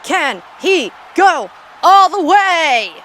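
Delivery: slow, high, booming mock-commentator shout; hyped-up shout. Cheering on the player in the game, hyped-up drawn-out shout. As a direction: holler each word drawn out, high and loud.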